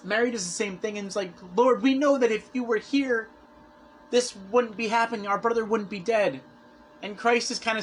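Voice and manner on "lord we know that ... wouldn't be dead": retold pleading. Voices grieving sisters reproaching Christ.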